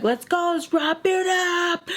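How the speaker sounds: high-pitched drawn-out rallying call; ready to go. Retold or imitated. Friend rallying the group to leave, imitated eager call. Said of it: "Like, she's ready to go".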